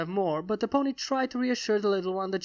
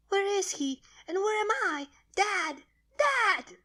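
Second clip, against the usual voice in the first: put-on high child voice rising to a desperate cry; screaming for her dad in panic; frantic scream; 12 semitones higher, slower than usual. Baby dragon screams for her missing father, frantic. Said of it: "she screamed".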